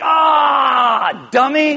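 loud high contemptuous scoff; imagined harsh Jesus scolding a stumbler, contemptuous; mock scolding. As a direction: scorn a clumsy child. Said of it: "Jesus is like".

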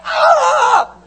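very high, loud shriek; hammed-up panic yell; terrified scream. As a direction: scream in mock terror. Acting out terrified disciples.

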